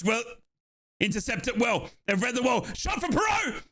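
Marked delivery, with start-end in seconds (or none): 2.7-3.6 sudden high-pitched commentary burst; excited shot call. Real, not acted. Commentator calls a sudden shot from Perot, excited. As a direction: jump up in pitch as the shot flies.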